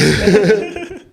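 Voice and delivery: short high burst of laughter; real laugh. Real, not acted. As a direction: laugh at the joke. Friend jokes don't do drugs.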